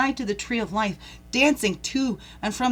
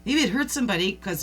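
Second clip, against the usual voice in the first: ordinary talk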